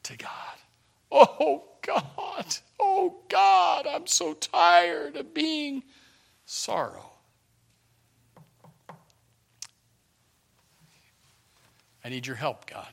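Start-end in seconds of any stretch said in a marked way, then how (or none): 1.1-5.8 high, drawn-out anguished plea; slow imploring wail, without shouting; pouring out the heart. Retold or imitated. Preacher showing a heart poured out to God, anguished high plea. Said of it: "just poured out your heart". 6.4-12.9 low, soft prayer with long pauses; spent, heartfelt plea. Retold or imitated. Preacher showing someone asking God for help, low hushed plea. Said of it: "just poured out your heart".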